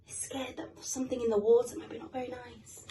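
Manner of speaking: ordinary delivery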